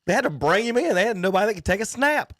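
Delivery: high-pitched, fast, indignant argument; exasperated protest. Real, not acted. Heated argument over the quarterback pick.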